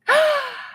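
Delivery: a high, sharp gasp of surprise. Real, not acted. Sharp gasp as she spots the gift in chat. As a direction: gasp as if the gift just popped up. Sudden delighted surprise on stream.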